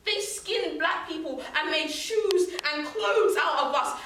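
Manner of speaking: impassioned, drawn-out accusation; indignant anger. Acted. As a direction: land each word slowly and forcefully. Poem voices anger at atrocities.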